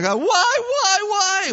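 high, wailing cry of God why; hammed-up anguished wail to heaven; mock screaming.

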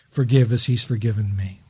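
ordinary talk, nothing marked

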